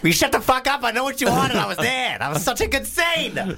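put-on very high Boston accent; excited film quote in a high Boston voice. Repeating the favourite film line to the host, Boston accent. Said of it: "do a Boston accent".

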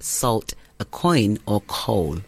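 ordinary talk

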